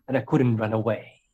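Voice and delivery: normal talking voice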